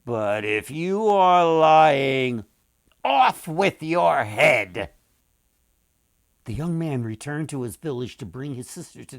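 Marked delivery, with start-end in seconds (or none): 0.1-4.9 loud, slow royal threat; slow and loud, a deadly warning; threatening king. A narrator's reading. The king warns the brother he will die, loud and slow. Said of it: "the king said".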